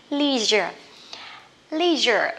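LEISURE as American "LEE-zher"; long EE in LEISURE, the American way. Shown as a demonstration. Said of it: "thích đọc theo kiểu Mỹ". (đọc LEISURE kiểu Mỹ, "li-giơ")

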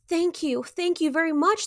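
high, bubbly happy thanks; playful thanks. A narrator's reading. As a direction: bubble over with delight. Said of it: "bubbled playfully".

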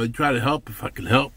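usual speaking voice

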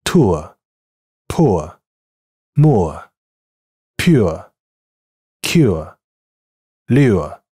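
non-rhotic British vowels, teacher demo; British English. Shown as a demonstration. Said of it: "英音". (英式发音示范)

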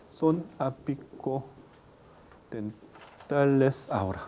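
normal talking voice

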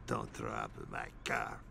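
very deep, low quoted driver. Retold or imitated. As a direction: drop way down into a gruff bass. Quoting the ride driver.

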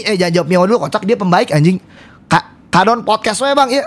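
ordinary talk

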